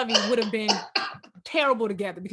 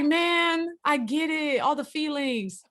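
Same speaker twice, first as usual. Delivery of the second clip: put-on weary kid; 4 semitones higher, 4 dB quieter, slower than usual. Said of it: "She was like".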